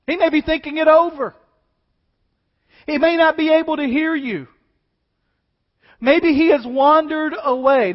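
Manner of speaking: loud, high storytelling sermon; full-force preaching. Acted. Preacher booming out the prophets' failure to the church. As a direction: drive the scene home.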